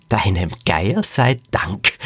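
slow line breaking into cackling; drawn-out thanks, then gloating chuckle; gloating laughter. Acted. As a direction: laugh wickedly after the words. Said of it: "lachte Frau Qualle".

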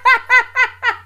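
laughing hard at the midnight call; real high laugh. Real, not acted. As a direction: laugh out loud at the story. Laughing at partner's story.

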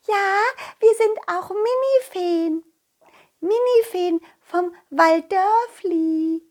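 high, slow tiny fairy voice; sing-song, high-pitched little creature; mini fairy. Acted. Mini fairy introduces her kind.

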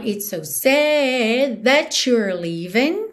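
"that you're" joined with a chu sound, "sad" held long; linked "that you're". Shown as a demonstration. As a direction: blend "that you're" into "tha-chur". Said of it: "tchu na junção".

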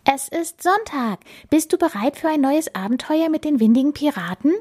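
high-pitched, rousing captain voice; rally a shipmate for adventure in a high voice; eager pirate captain. Acted. Captain inviting Bella to a pirate adventure, eager.

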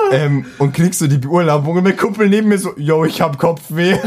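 laughing. Retold or imitated. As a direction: giggle through the friend's lame excuse. Friend's lame excuse, retold laughing. (lachend den Kumpel nachgemacht)

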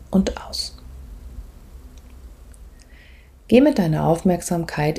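soft, calm meditation voice, often whispered; guided meditation. Acted. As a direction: guide the listener gently inward.

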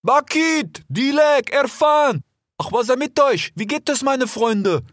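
high, carrying call to friends. A narrator's reading. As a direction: hail them from afar. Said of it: "Dann rief Fennec".

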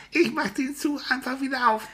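hohe, flinke Vogelstimme; fröhlicher Spatz, viel höher, munter; Spatz Frido. Acted. Frido spricht weiter. (Spatz Frido stellt sich den Kindern fröhlich vor)

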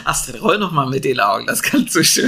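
lachend necken. Real, not acted. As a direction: mit Lachen in der Stimme. Neckt Astrid lachend. (lachend gesprochen)

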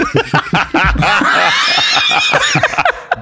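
high shrieking laugh. Real, not acted. Findet Döner in der Dose. (lauter, hoher Lachanfall)

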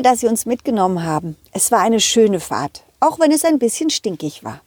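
ordinary delivery, nothing marked

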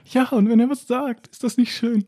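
high, slow, dreamy mock voice; mock swooning friends. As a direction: gush over him like the friends, overdone. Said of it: "So nach dem Motto". (hohe, langsame, schwärmerische Spottstimme)

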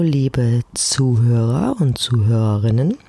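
ordinary talk

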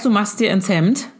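ordinary delivery

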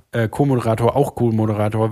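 ordinary delivery

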